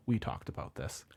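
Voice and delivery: low, hushed letdown; quiet retold rebuff. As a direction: imitate her quietly shutting him down. Retells woman refusing a proposal.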